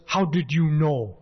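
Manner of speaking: imitated West African accent; Burkina Faso accent. Neighbor answering the door to Ali, imitated African accent. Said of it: "I love his accent".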